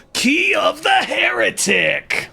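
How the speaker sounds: high, slow triumphant shout; excited shout. Real, not acted. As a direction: belt out the item name. Announcing item pickup.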